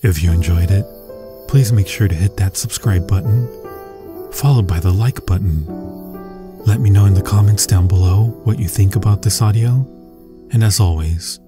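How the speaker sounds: ordinary talk, nothing marked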